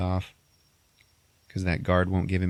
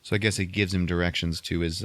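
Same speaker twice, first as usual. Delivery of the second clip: ordinary talk, nothing marked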